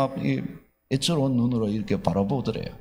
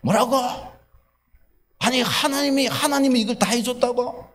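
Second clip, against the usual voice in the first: glare at her and throw it back in disbelief; incredulous angry husband; 10 semitones higher than usual. Retold or imitated. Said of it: "갑자기 아내를 이렇게 쏘아보면서". (아내를 쏘아보며 믿기지 않는다는 듯 높고 큰 목소리로)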